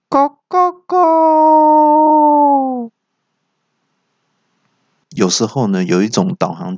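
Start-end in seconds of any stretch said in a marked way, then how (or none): 0.1-2.9 高亢拉长的假公鸡叫; 像公鸡一样拉长音啼; 公鸡啼叫. Acted. 导航机自称小公鸡. (导航机装成公鸡高声啼叫)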